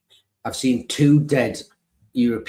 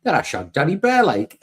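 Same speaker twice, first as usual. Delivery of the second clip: loud, higher Geordie footballer impression; Geordie accent; 5 semitones higher, 5 dB louder than usual. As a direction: switch into a broad Geordie accent. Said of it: "in a Geordie accent".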